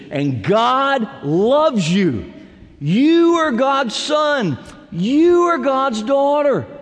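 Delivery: high, loud, slow preaching that swells to a shout; preaching at full force. Acted. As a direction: proclaim it to the whole congregation, slowly. Preacher builds to a peak.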